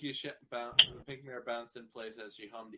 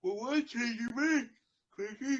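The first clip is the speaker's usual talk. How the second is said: voicing [name]; 11 semitones higher, 7 dB louder than usual. [name] voicing character, high and loud.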